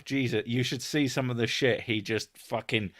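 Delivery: ordinary talk, nothing marked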